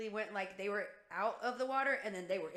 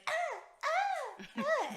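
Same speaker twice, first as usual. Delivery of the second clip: very high imitated child's squeals; mock cranky wails in short bursts; fussy waking whine; much slower than usual. Mom imitating daughter woken early, fussy squeals. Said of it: "she hates waking up".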